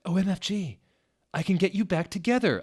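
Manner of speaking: high, fast, excited gush; excited high squeal. A narrator's reading. Narrator gushing to Satan about reuniting him, excited. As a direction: blurt it out thrilled.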